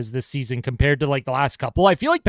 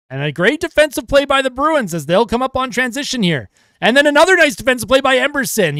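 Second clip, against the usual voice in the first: excited play-by-play; 10 semitones higher, 5 dB louder than usual. Acted. Excited call of defensive play.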